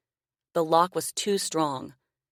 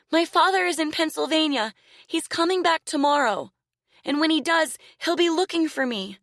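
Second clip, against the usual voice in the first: put-on high, loud pleading child's voice; cling to hope of daddy; frightened little girl; 12 semitones higher, 4 dB louder than usual. A narrator's reading. Girl insists her father comes.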